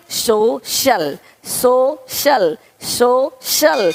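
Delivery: सोशल split slowly into "so" and "shal"; drawn-out syllables. Shown as a demonstration. Teacher showing students the sh sound, सोशल slow and split. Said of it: "तो यहाँ पे शल हो रहा है".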